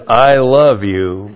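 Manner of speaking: slow, drawn-out I love you; imitating a date saying I love you; mock smooth-talking date. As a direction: say it like a guy on a date, slowly.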